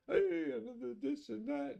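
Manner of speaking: hammed-up high cheerful YouTuber voice; cheery YouTuber parody. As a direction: lampoon a peppy tutorial host. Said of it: "Telling me".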